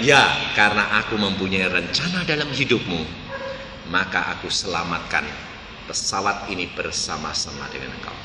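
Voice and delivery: low, hushed, solemn; voice of Jesus. Retold or imitated. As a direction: fade low and soft like a divine voice. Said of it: "dia melihat". (suara Tuhan yang rendah dan lirih)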